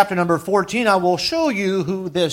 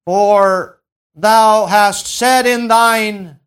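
scripture proclamation; 4 semitones higher, 5 dB louder than usual. Acted.

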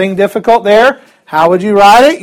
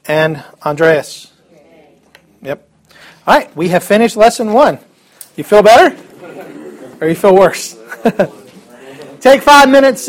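normal talking voice

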